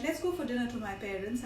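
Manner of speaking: ordinary talk, nothing marked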